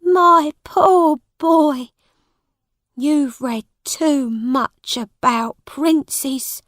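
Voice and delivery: high, slow, drawn-out pity; pitying mother. A narrator's reading. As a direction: lament slowly over your poor boy. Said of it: "said Missus Canty".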